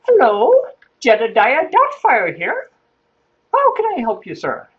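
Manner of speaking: high, loud English nanny voice; put-on falsetto English lady; Missus Doubtfire. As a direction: sing out a hammed-up English matron's phone greeting. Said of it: "so I decided that's what I was gonna do".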